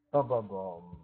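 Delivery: loud, ominous sung sting; dramatic dun dun dun. Acted. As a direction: hum a doom chord like a movie. Dramatic sting after ogre's threat.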